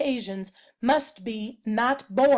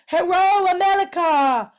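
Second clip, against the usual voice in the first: loud, high, hammed-up hello; exuberant greeting; 6 semitones higher, 7 dB louder than usual. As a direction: belt out an over-the-top cheerful greeting. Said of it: "He speaks Chinglish".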